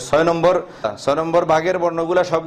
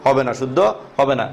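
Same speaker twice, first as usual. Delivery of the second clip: ordinary talk, nothing marked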